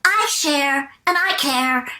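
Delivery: high, slow cartoon bear; sweetly stretched, high-pitched character catchphrase; Cher Bear. Shown as a demonstration. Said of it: "who had a very similar voice".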